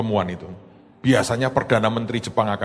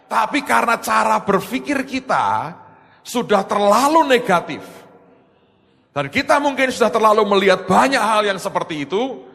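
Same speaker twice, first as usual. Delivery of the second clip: normal talking voice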